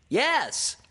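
high, loud squeak; eager piercing reply; Piglet. A narrator's reading. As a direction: shout it in a piping voice. Piglet confirms loudly.

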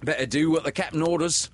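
fast, high, loud nervous urging; a jittery pirate hurrying his mate; nervously. Acted. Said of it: "said Starkey, nervously".

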